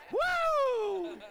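loud, very high joyful woo; whoop. Retold or imitated. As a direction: whoop with sudden joy. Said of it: "because he went".

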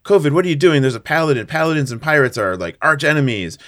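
urgent, hammed-up objection; alarmed protest. Pirate challenging her buddy over the Paladin, alarmed protest.

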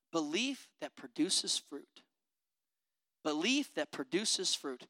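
soft, low, slow belief that produces fruit; quiet definition. Shown as a demonstration. Preacher defining faith for the church, soft and low. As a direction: lay it down quietly. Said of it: "Faith simply defined as this".

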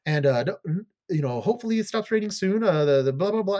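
high, loud mock-crying weather report; retold tearful forecast. Weather girl forecasting for the room boss, mock crying. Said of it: "she's crying".

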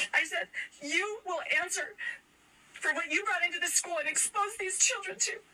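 high, tear-choked retelling; tearful. Real, not acted. Mother reliving her words to the principal, crying. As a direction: keep pushing through the tears. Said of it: "but now I am crying".

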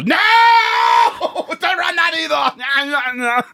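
high laughing shriek. Acted. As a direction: squeal it out in mock horror. Mock horror yelling at the idea.

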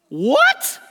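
high loud startled What; shocked what. Retold or imitated. A moviegoer reacting in shock, high and loud. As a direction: blurt it out in disbelief.

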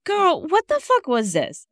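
high-pitched incredulous outburst. Real, not acted. Co-host reacting to the story's plot, incredulous. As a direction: call out the absurdity in a high, disbelieving voice.

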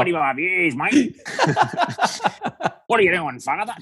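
a broad Australian parishioner, played for laughs through giggles; mock Aussie twang, laughing; Aussie accent. Shown as a demonstration. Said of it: "say that with my Aussie accent".

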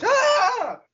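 high, loud yell in a put-on voice; Yoshihashi yell. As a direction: scream it at the mirror. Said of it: "Looks at his face".

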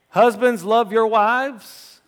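loud, slow preacher boom; preaching loudly. Acted. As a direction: thunder the verse out slowly.

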